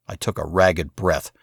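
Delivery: plain reading voice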